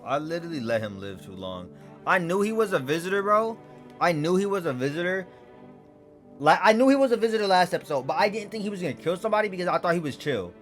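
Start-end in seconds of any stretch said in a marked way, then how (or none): 2.1-10.5 louder, higher, fast; excited rant. Real, not acted. Frustrated rant about visitor.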